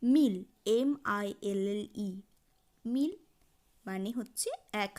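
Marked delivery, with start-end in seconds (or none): 0.0-3.1 "মিল" isolated and loud, then spelled out; French pronunciation demo. Shown as a demonstration. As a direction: punch "মিল" as one syllable for learners. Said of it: "তখন বলবো".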